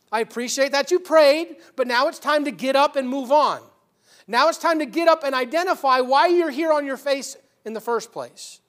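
loud, high, urgent command; commanding preaching. Acted. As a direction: order him up off his face. Lord's voice preached loudly.

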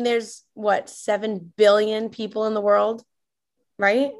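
ordinary delivery

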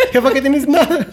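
a mom pretending nothing's wrong; mock sulky mom. (mamá ofendida exagerada)

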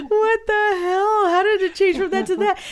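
laughing through the words, voice up high. Real, not acted. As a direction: squeeze it out while cracking up. Laughing retelling the dream.

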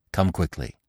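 ordinary delivery

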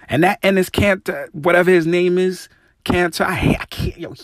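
high, strained with frustration; exasperated. Real, not acted. Host ranting at the commentator, exasperated. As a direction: spit the name out in disgust.

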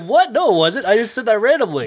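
high, loud re-enacted protest; startled denial. Retelling his denial to accusing friends, startled. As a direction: protest in baffled disbelief.

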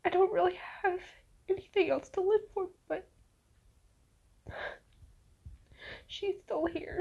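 crying. Real, not acted. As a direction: voice breaking into real tears. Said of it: "Try to stop crying".

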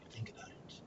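breathy whispered aside; whispering. Real, not acted. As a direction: drop to a secret whisper. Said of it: "whispered aside after fun fact".

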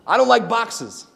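ordinary talk

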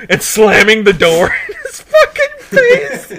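real high, loud laughing squeal; laughing while talking. Real, not acted. Storyteller describing the slammed door, loud high laughter. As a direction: get the words out through loud laughter. Cracking up at his own story.

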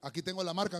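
ordinary talk

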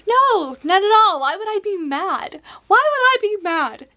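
high, loud, over-insistent denial; hammed-up "I'm totally not mad" voice; mock indignant denial. Acts own mad texts in denial.

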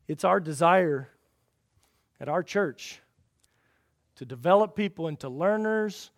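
ordinary delivery

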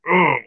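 high, loud "oh" of intense feeling; burning feeling grunt. Shown as a demonstration. Showing listeners what burning feeling is, emphatic groan. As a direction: groan it out from the gut. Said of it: "like, a burning".

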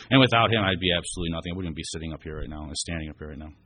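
usual speaking voice

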